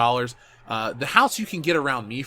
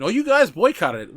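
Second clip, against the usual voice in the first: higher, louder whiny accusation; mock the critics blaming him; a hammed-up finger-pointing voice; mock accusing; 8 semitones higher, 7 dB louder than usual.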